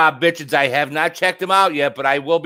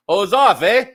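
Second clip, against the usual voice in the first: mock Canadian; 5 semitones higher, 4 dB louder than usual.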